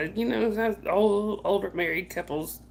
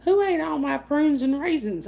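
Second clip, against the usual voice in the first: high, loud demanding voice; high indignant mock demand; mother-in-law's accusing question; 7 semitones higher, 4 dB louder than usual. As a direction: quote her accusing you. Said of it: "she's like".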